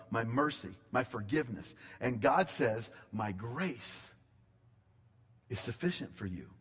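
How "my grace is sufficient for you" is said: softer and lower, gently reassuring; tender quote. Acted. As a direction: speak God's promise softly to a hurting listener. Quotes God's promise again.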